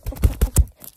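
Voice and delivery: sharp loud clicks; loud clicking. Acted. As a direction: snap out each click loudly. Loud clicking mouth sounds.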